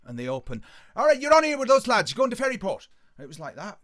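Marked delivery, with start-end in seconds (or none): 0.9-2.9 high, very loud, excited call; shouted exchange. Retold or imitated. Man at the bus door to the lads, shouted. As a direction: holler it as a bustling lad.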